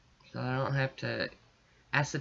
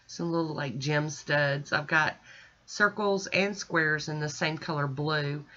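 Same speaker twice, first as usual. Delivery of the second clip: normal talking voice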